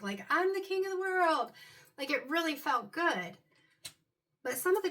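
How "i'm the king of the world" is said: exultant movie-quote shout, high and loud; Jack from Titanic. Retold or imitated. Reader quoting a Titanic line, triumphant and high. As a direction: cry it out high, top of the world. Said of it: "Leonardo DiCaprio as Jack in the Titanic".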